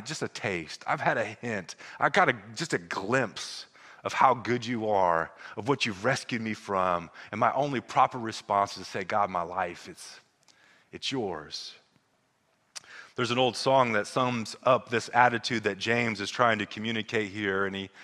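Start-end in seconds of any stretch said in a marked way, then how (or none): 0.0-11.8 low, hushed and heartfelt; grateful prayer. Acted. Believer tells God "my life, it's yours", grateful prayer. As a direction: soften and drop low, as if confiding in God.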